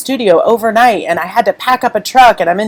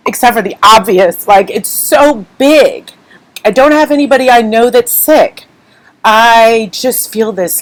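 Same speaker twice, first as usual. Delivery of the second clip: normal talking voice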